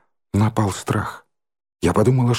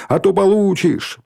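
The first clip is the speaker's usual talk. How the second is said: high-pitched angry warning; heated, higher cry; shouted threat; 8 semitones higher than usual. A narrator's reading. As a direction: call out a threat in a raised pitch. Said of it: "крикнул Димка".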